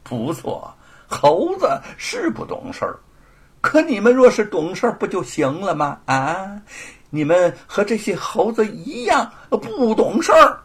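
chuckling, condescending bully; mocking laugh. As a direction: sneer while laughing at them. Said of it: "罗鹏呵呵地笑着". (呵呵笑着嘲讽)

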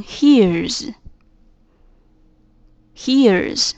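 "hears" slowly, ending in a buzzed z; voiced Z ending. Shown as a demonstration. Teacher showing how hear changes, "hears" with voiced z. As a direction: draw out "hears" with a voiced z at the end. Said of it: "读音就会加上Z的音".

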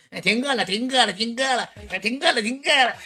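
very high, squeaky put-on heckler voice; mock whiny chant in a tiny high voice. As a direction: pipe up like a pestering listener, way up high. Acting out hecklers who heard it.

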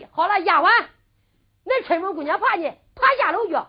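loud, high-pitched retort; exasperated outburst. Acted. Girl retorts about the maid.

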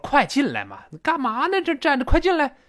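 high, insistent "come in"; coaxing a guest to come in, impatient and high; impatient urging. Acted. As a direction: hurry someone inside, half-pleading.

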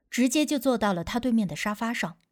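ordinary delivery

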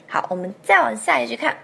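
normal talking voice

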